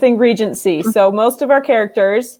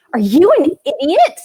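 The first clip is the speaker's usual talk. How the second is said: raised, indignant mock question; incredulous; 6 semitones higher, 4 dB louder than usual. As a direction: imitate an exasperated taxi driver. Retells taxi driver's exasperated question.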